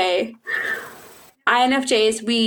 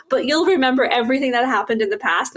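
ordinary delivery

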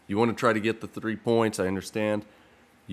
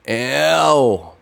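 sharp wincing ow; pained ow; 4 semitones higher, 6 dB louder than usual. Real, not acted. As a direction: wince out loud. Reacts to a play.

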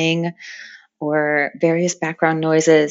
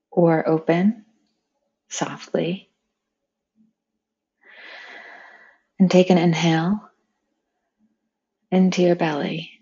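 unhurried meditation voice with long pauses; guided meditation. Acted. Meditation leader inviting friends to picture flowing water, calm and spacious. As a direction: guide them gently, phrase by phrase, leaving space.

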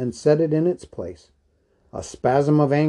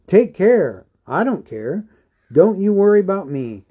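excited brushing off; 6 semitones higher, 5 dB louder than usual. Acted. Bilbo waves off Gandalf's care.

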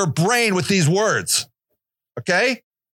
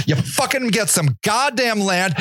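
ordinary talk

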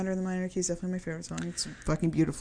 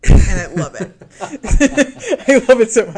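laughing loudly through it; gushing laugh while talking; 4 semitones higher, 15 dB louder than usual. Real, not acted. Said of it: "laughing while saying it".

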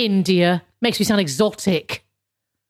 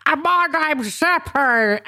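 higher, slow put-on voice for Zeppo; Janet Street Porter-like Zeppo; 6 semitones higher, slower than usual. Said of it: "much less like Janet Street Porter than I did it before".

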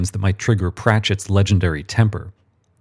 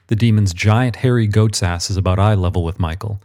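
plain reading voice

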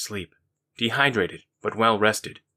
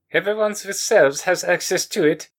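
higher voiced Finnish accented man; 9 semitones higher than usual. Mister Voorhees states the fact, Finnish accent. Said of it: "In his Finnish accent".

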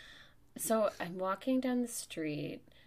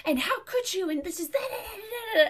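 high, loud mock screeching rant; shrieky mock tirade; imitated yelling; 13 semitones higher, 9 dB louder than usual. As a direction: yell it as a hammed-up angry stranger, pitch way up. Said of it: "you can't stop yelling at me".

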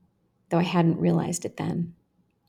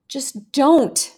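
higher, deliberate plea; slow, held plea; 7 semitones higher, 4 dB louder than usual. A narrator's reading. Girl staring the boy down, slow firm plea. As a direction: say it slowly, holding your breath. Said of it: "I said slowly, holding my breath".

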